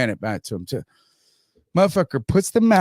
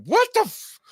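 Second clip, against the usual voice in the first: very high, cut-off yelp; acted-out squeaky disbelief; retold startled shock. Acts out startled reaction to shot.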